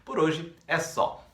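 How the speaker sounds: ordinary talk, nothing marked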